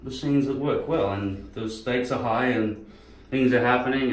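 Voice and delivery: ordinary delivery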